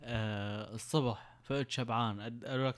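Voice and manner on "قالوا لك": ordinary delivery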